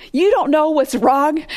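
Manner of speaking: indignant "You don't know?"; wife snapping back at her clueless husband; exasperated wife. Retold or imitated. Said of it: "I can just imagine Hannah saying".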